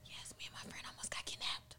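hushed conspiratorial whisper; breathy gossip whisper. Real, not acted. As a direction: lean in and whisper the secret.

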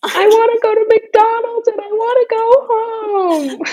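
put-on high, whiny child's wail; crying little kid. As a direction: whimper it like a tired kid face down on the ice. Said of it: "he was mic'd up and he was like".

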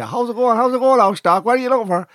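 imitated local Irish takeaway man; pure clad accent. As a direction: mimic a broad local greeting. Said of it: "he's pure clad accent".

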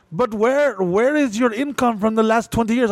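high, lightly voiced auditor questioning; imitating auditor. Retelling the IRS agent's questions, imitated. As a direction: press him like a persistent auditor. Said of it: "She's like".

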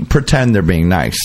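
usual speaking voice

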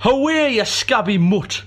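high, loud bellow at a dog. Acted. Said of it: "came the bellowing sound".

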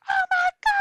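high, loud panicked cry; panicked shriek. Retold or imitated. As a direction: screech it in full panic. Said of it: "They're like".

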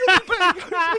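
high, slow mock Australian drawl. Australian player mocking the gaffer, high mock Aussie. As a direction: ham up the Aussie youngster.

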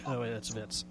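normal talking voice

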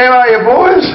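high, cheery fake greeting; mock friendly.

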